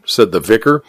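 usual speaking voice

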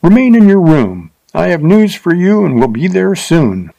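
high, lifted cheerful call. A narrator's reading. Rider greets Willie Pond at his window, cheerfully. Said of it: "said in a cheerful voice".

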